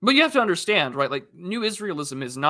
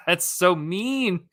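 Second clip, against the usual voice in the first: 1 laughing; 7 semitones higher, 6 dB louder, much slower than usual. Real, not acted. Laughing at a chat joke.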